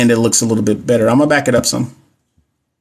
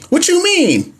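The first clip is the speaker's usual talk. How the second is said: incredulous squeak; slower than usual. Real, not acted. Incredulous at answer C.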